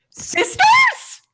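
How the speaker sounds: put-on very high shouted old-lady question; old woman's shout. Old woman at the creperie shrieking her guess, very high. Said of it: "she's like".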